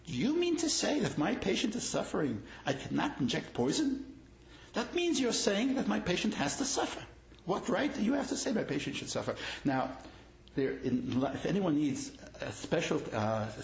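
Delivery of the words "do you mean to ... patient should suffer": indignant challenge. Retold or imitated. Doctor indignantly challenges the rabbi.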